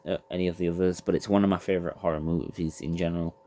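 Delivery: ordinary talk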